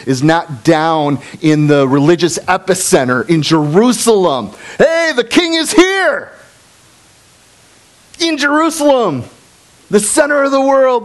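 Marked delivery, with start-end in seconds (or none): 4.8-11.0 high, excited herald call; a crowd cheering that the king has come; excited announcement. Pretend. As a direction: announce the king with mock thrill.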